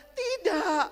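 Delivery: high, loud tearful no; girl answering her teacher through tears; crying girl. Acted. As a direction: sob out the answer.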